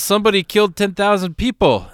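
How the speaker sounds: put-on high, loud drunken announcement; tipsy street musician. Drunk guitarist telling missionaries news, loud tipsy voice. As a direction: blurt it out like a drunk. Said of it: "because he'd been drinking".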